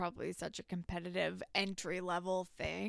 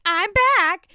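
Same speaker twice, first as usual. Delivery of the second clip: high, loud, grand entrance; squealing I'm back, mocking Paige; mock dramatic announcement; 12 semitones higher, 15 dB louder than usual. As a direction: announce your return like a diva. Paige's dramatic return, acted quote.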